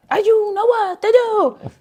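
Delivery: high, loud, stretched-out count-in; slow, exuberant bandleader call, imitated. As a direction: belt out the cue with huge energy. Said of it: "the most energetic count".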